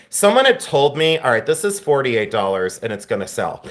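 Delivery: ordinary talk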